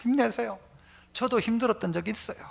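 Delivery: softly cheering up a deacon; soft, kind, reassuring words; gentle encouragement. Retold or imitated. 성도가 힘든 집사님을 부드럽게 격려함. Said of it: "가서 격려해줘라".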